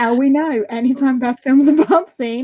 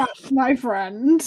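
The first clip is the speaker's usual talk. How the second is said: slow, savouring "that's my friend"; slow deadpan echo of "That's my friend"; slow amused echo; slower than usual. Acted. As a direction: drag out "my friend" teasingly.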